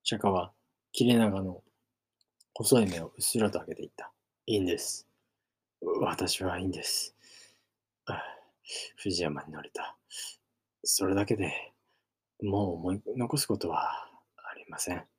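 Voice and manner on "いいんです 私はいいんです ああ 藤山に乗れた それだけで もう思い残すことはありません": soft, fading to a whisper; barely getting the last words out; weak farewell trailing off into a whisper; feeble dying voice. Acted. Dying friend speaking weakly.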